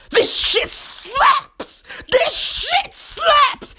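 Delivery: high, drawn-out hype exclamation; slow, high-pitched gushing; hyped-up music fan. Acted. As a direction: stretch it out high like you're loving the track. Acting out enjoying his music.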